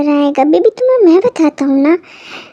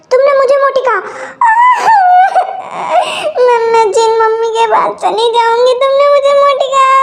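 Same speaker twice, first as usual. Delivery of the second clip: hammed-up wailing; 6 semitones higher than usual. Wife wails, called fat.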